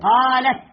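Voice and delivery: قالت with a vowelless final ت; silent final t. Shown as a demonstration. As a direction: say قالت ending on a closed t. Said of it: "تاء التأنيث هي ساكنة".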